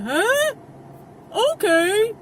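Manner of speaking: loud, high-pitched mock cluelessness; oblivious other person. Distracted dog owner not processing the warning, mock clueless. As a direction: imitate someone not taking it in. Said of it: "the other person's like".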